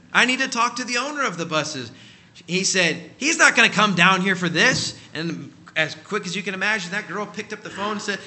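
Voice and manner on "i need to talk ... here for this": louder, higher standoff; both sides digging in; rising argument. Retold or imitated. Quarrel with the manager.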